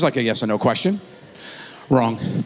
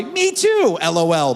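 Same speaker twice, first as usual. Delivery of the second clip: much higher, giddy; high gleeful texter; 7 semitones higher, slower than usual. Retold or imitated. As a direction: squeal it. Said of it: "they reply".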